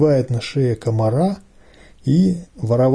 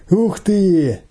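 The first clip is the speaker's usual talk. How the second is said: higher-pitched delighted exclamation; a burst of boyish glee; merry amazement; 6 semitones higher than usual. A narrator's reading. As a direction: exclaim with amused wonder. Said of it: "веселится Саня".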